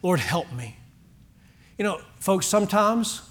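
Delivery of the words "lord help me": lower, softer broken plea; soft desperate prayer; humble plea. Retold or imitated. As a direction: beg quietly, lowly. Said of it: "just simply said this".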